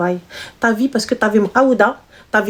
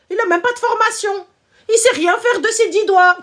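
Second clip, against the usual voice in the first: much higher, mocking a crybaby; mock whiny voice; 10 semitones higher than usual. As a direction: taunt her in a high whiny voice. Mocking a crying girlfriend.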